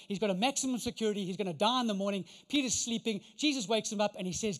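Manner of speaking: ordinary talk, nothing marked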